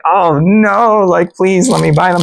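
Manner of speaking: pleading. Retold or imitated. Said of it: "which I was just like".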